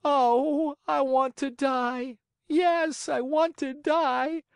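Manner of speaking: put-on high, drawn-out childlike wail; hammed-up puppet despair. Puppet wails over dead fairy.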